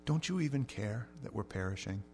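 disciples' plea voiced softly; hushed plea. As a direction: say it quietly, as a heartfelt question.

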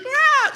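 short, high, loud yelp of terror; sudden acted shriek of fright; startled scream. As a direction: scream as if you just saw a ghost. Rowers see figure approaching in storm.

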